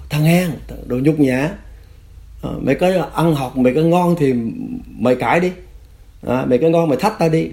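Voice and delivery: high-pitched mock berating; taunting interrogator. Cadre trying to anger his prisoner, acted taunting. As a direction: goad him hard, stretching the insults.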